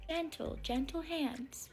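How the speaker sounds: put-on soft slow babying parent voice; mock gentle coax. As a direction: coo it softly and slowly. Parent softly coaxing kid.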